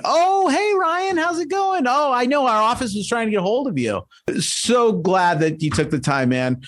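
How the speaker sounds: excited greeting. Pretend. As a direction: greet like an old friend. Role-play enthusiastic greeting.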